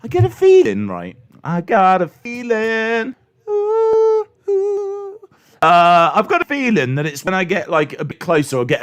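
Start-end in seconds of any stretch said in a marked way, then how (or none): 3.5-5.2 high squealing ooh. Pretend. Squeal after voice crack.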